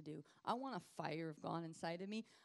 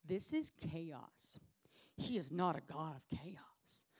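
slow skeptical quote; 3 semitones lower, slower than usual. Retold or imitated. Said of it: "And you're like".

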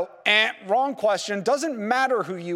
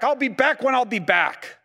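higher-pitched, mock-annoyed comeback; mad; 5 semitones higher, 4 dB louder than usual. Husband refuses to tell his wife his return time, acted mad. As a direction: play the irritated husband ending the questions. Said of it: "I'm all mad".

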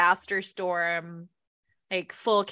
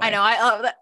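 normal talking voice

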